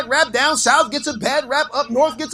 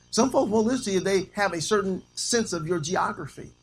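ordinary talk, nothing marked

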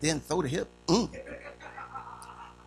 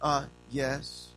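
softer, lower, mild reply; polite answer; 4 semitones lower, 6 dB quieter than usual. Shown as a demonstration. Child answering a parent's question, mild polite tone. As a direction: answer mildly, with a little hesitation. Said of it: "What happened to".

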